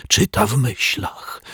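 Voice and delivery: a frightened detective caught off guard; panicked. A narrator's reading. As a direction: blurt it out in sudden panic. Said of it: "spanikował".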